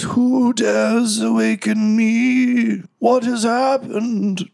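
very high, slow ancient creature voice; unearthly voice. Acted. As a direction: stretch it out like something long dead waking. GM voices waking vampire mummy.

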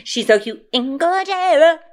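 high, slow, adoring gush. Real, not acted. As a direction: coo over the photo. Gushing over a friend's mugshot.

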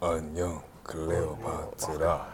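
quiet, very low and slow. Acted. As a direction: sink into the lowest note you have. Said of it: "we're gonna go as low as we can".

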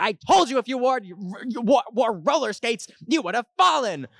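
high, scolding mock yell; mom yelling. Mom yells at the fallen child, high scolding. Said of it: "She was yelling at the child".

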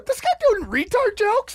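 very high, incredulous imitation of a kid; bewildered kid. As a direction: act out an offended kid in the crowd. Voices offended kid in the audience.

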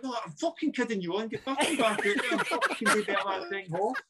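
mock gaffer laughing. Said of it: "He's like".

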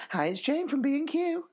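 high phone voice of a shop assistant; shop worker voice. Retold or imitated. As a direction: squeak it out like the chirpy lady on the phone.

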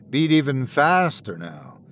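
ordinary delivery

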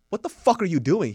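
angry shout. Retold or imitated. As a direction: confront the guy shaking legs. Doctor confronting leg-shaker.

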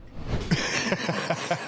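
a short breathy chuckle; sheepish laugh. Real, not acted. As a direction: laugh at your own slip. Laughs after the confession.

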